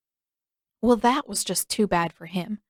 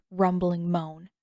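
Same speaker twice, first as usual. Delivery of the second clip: plain reading voice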